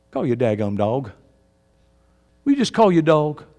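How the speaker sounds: ordinary talk, nothing marked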